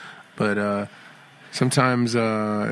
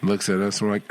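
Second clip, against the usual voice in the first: ordinary talk, nothing marked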